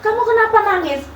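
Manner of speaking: high, quick imitation of the pastor; pastor's voice. The pastor asks her why she cries, high voice. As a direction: voice the pastor asking, pitched high. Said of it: "Saya dikasih tahu".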